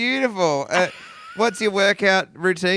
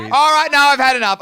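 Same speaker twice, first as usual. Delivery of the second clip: loud, high, playfully fed up; mock exasperation; 8 semitones higher, 7 dB louder than usual. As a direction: throw up your hands, loudly.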